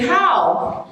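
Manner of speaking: high, quick slangy how. Shown as a demonstration. Informal street greeting, high slangy how. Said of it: "Sometimes you hear people say".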